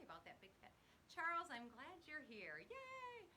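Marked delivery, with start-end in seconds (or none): 2.6-3.3 high-pitched, drawn-out little yay; happy cheer. Real, not acted. Host greeting arriving viewers, high happy cheer.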